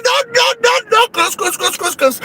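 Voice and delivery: very high frantic chant of cruz; frantic warding off. Retold or imitated. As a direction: ward off the witch in panic. Ahuyenta a la suegra con cruces. (conjuro agudo y frenético)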